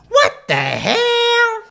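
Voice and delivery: high, slow disbelieving squeal; wild unhinged ranting. Real, not acted. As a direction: shriek it in stunned disbelief. Sudden outburst at trailer.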